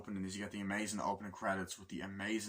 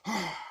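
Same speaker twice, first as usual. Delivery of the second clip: high, loud imitated war cry; imitated roar; 9 semitones higher, 5 dB louder than usual. Nicolas Cage's character attacking the man, roaring. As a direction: roar as you lunge in. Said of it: "but he's like".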